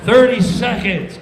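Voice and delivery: loud countdown; stage manager calling thirty seconds. Retold or imitated. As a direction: bark the time left.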